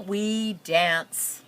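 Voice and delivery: plain reading voice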